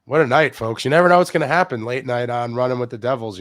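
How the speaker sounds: upbeat late-night show host; host intro voice. Acted.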